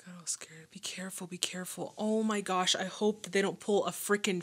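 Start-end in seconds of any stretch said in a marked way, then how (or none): 0.0-1.3 hushed, voiceless whisper to the screen. Real, not acted. Tense moment, whispering to screen. 1.3-1.9 whisper. Real, not acted. Tense moment, whispering to screen.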